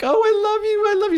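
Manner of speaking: mock a mother cooing at her crying child; clingy mom. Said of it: "be like".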